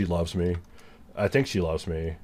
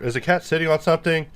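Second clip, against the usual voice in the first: surprised; 9 semitones higher, faster than usual. Real, not acted. Cat on keyboard, startled.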